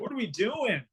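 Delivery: high-pitched mock disbelief; incredulous retold question. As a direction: ask it as if amazed at the absurdity. Retelling amazement at sudden warm weather.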